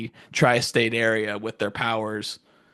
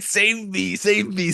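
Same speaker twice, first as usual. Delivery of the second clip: mock plea for help, pitched up; lightly hammed-up cry to be saved; 7 semitones higher, slower than usual. Acts out snail pleading for rescue.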